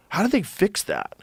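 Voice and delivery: normal talking voice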